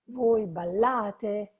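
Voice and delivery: "Voi ballate" with the stress on la, slow. Shown as a demonstration. Teacher showing the class the stress in ballate. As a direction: lean on the a of "ballate", slowly. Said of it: "A is your emphasis".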